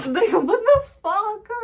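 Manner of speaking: a high, loud, slow shocked cry; shocked outburst. Real, not acted. Reacting to the dog locked out, shocked loud cry. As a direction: stretch it out in stunned disbelief.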